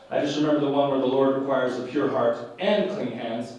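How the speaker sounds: ordinary talk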